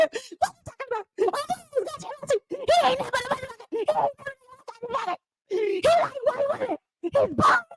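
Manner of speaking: high, fast indignant rant; heated Tasha K. Retold or imitated. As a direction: squeal it out in a fast high fury. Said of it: "Tasha K was heated".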